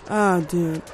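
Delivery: usual speaking voice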